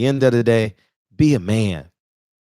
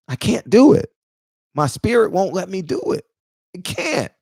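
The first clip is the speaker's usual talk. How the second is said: near tears; 6 semitones higher than usual. Real, not acted. Refusing to stand beside Floyd, close to crying. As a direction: voice cracks into real tears. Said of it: "I'm probably gonna cry".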